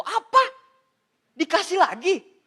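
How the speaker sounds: high, loud surprised cry; mock shock. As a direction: act stunned by yet another transfer. Kaget dapat transfer lagi. (kaget pura-pura, nada tinggi dan keras)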